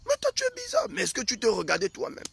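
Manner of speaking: very high, loud mock accusation; accusing wife. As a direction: squeal it like a wife accusing her husband. Voices wife accusing husband.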